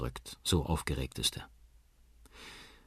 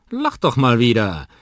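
loud, higher, cheering-up call; 6 semitones higher, 12 dB louder than usual. Acted. Uncle urges sad Liam to laugh, calling out. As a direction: coax a smile out loudly. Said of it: "ruft Onkel Danny".

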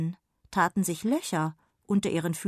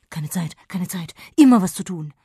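fast, busy, louder fairy voice; hurried fairy; 10 dB louder than usual. Acted. Sausewind calls back from afar, hurried. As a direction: rattle it off, no time to stop. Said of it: "rief Sausewind von irgendwoher".

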